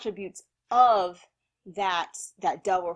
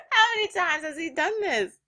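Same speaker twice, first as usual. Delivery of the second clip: laughing disbelief; 9 semitones higher, 3 dB louder than usual. Real, not acted.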